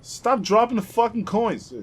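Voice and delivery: slow, exasperated shout; angry yell. Real, not acted. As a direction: bellow it slowly in frustration. Yelling at game.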